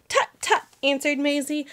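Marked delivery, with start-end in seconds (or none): 0.1-0.6 higher, brushing it off; tut-tut like a fussy bird; light mock tutting bird; dismissive bird.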